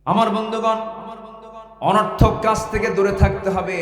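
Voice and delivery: high, loud preaching voice; rousing address. Real, not acted. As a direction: call the congregation to attention. Preacher launches a new point. (উঁচু গলায় জোরালো আহ্বান)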